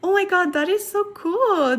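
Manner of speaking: ordinary talk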